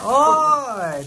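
calling out. Real, not acted. Calling out over the table.

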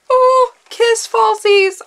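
high, loud, drawn-out delight; delighted squeal. Real, not acted. Vlogger finding false lashes in a package, delighted squeal.

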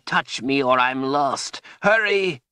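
high, strained plea rising to a cry; desperate urging. A narrator's reading. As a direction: beg urgently as if slipping away. Trapped wizard begging for help.

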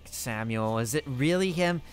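ordinary talk

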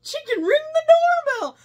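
very high, loud excited cry; delighted squeal. Retold or imitated. Joining in as the amazed neighbor, high delighted squeal.

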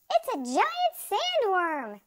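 high, loud alarmed shriek. A narrator's reading. Another beachgoer sees the strange creature, shrieking. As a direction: shriek it, stretching the last word. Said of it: "shrieked another".

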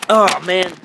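ordinary talk

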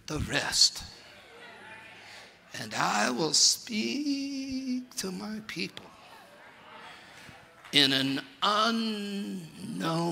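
very slow, soft and drawn out; slow motion. Shown as a demonstration. Preacher repeating Isaiah verse, soft slow motion. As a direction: stretch every word to a crawl. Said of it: "let me slow down, slow motion".

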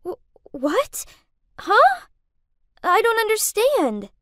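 high-pitched, stammering confusion; shocked, halting, voice jumping up; spluttering bewilderment. A narrator's reading. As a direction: splutter it out, baffled and upset. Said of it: "Kath spluttered out".

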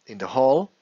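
normal talking voice

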